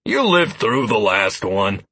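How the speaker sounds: slow, dismissive brush-off. A narrator's reading. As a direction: wave the worry away. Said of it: "Lazarus said dismissively".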